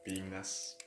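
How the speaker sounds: ordinary talk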